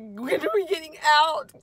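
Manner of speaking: high, loud impatient dog voice; voicing the dog. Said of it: "she's like".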